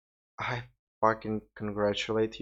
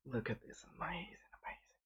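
breathless awed whisper; hushed, fast and admiring; 16 dB quieter, faster than usual. Real, not acted. Admiring own table in hushed awe.

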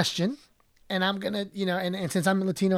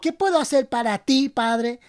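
put-on Mexican accent. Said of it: "your best Mexican accent".

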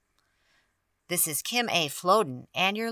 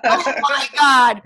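high, loud, slow through laughter; mock shock, laughing; 9 semitones higher, 8 dB louder, slower than usual. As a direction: gasp it out laughing. Retold laughing reaction.

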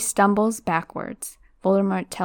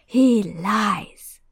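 the same words pushed out louder and fuller; the hidden voice pressing its claim; louder insistent repeat; 4 dB louder than usual. Acted. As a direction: raise it, insisting. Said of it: "the high voice speaks again".

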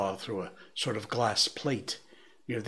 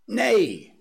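high, much louder defiant shout; 11 semitones higher, 9 dB louder than usual. A narrator's reading. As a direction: roar it at the top of the lungs. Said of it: "shouted the curate at the top of his voice".

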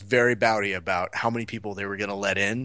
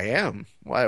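ordinary talk

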